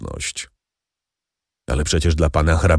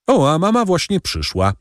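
higher-pitched exclamation of a son; calling out; 11 semitones higher than usual. A narrator's reading. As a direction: call it out high, pleasantly surprised to see mother. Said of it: "zawołał Albert". (zawołanie wyższym głosem)

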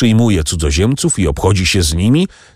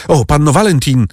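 higher, lightly urgent call; 7 semitones higher than usual. A narrator's reading. Franz turns to Valentin for help, calling out. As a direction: call out to her with a raised pitch. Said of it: "zawołał Franz".

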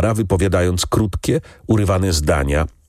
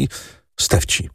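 whispered ending; 3 dB quieter than usual. A narrator's reading. Granddaughter confiding whom she owes it to, whispered. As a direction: trail into an unvoiced whisper. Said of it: "dokończyła szeptem".

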